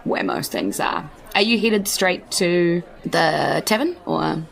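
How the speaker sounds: ordinary talk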